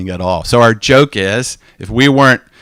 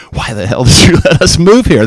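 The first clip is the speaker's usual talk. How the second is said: mock complaint; 4 semitones higher, 7 dB louder than usual. Retold complaint in Baghdad.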